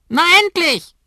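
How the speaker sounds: schrilles, sehr hohes Papageienkrächzen; krächz es wie ein Vogel; krächzender Kakadu. A narrator's reading. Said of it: "krächzt Oskar". (Kakadu krächzt laut und hoch)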